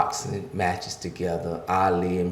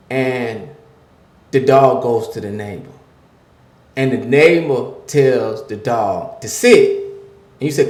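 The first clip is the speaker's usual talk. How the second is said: rising, louder preacher's cadence; preaching; 5 semitones higher, 8 dB louder than usual. Acted. Pastor preaching to the men listening, building and louder. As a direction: drive the point home like a sermon.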